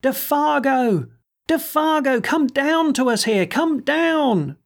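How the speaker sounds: high, slow call to the sky; a desperate high summons, drawn out; crying aloud. A narrator's reading. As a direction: call up into the dark for the lost man. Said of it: "he cried aloud".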